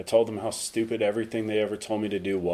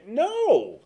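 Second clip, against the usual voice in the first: mock curt no; 7 dB louder than usual. Voicing the refusal on the phone.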